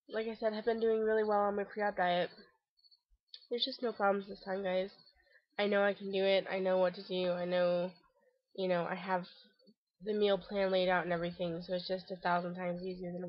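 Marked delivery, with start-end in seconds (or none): none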